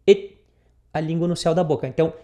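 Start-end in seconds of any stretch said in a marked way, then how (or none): 0.1-0.4 It said with a short E sound and the T stopped by the tongue; pronouncing it. Shown as a demonstration. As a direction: say It as "et", tongue locking on the T. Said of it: "o T já tranca na língua". ("It" com som de E e o T travado na língua)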